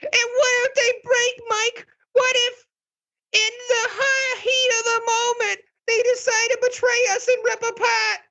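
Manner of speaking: very high, tearful drama queen wail. Acted. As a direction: wail it like a stage diva close to tears. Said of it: "this is my drama queen voice".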